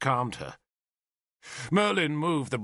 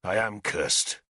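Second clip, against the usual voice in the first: low, slow, bitter answer; 5 semitones lower, slower than usual. A narrator's reading. Arthur answering Nimue's threat of a curse, bitter. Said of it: "he answered bitterly".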